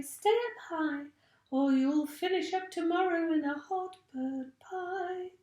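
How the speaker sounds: high, lilting chant of a warning; bird's singsong warning. A narrator's reading. Roly-poly bird warning the other birds away, high singsong. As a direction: sing it out like a bird calling from above. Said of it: "singing out his warning".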